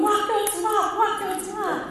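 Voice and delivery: put-on very high, loud child's chant; gleeful little boy. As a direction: mimic a gleeful child cheering on animals. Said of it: "Elias is all clapping and laughing and going".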